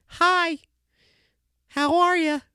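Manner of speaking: squeaky high hello; high-pitched greeting. Pretend. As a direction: greet them in a tiny high voice. High greeting to new listeners.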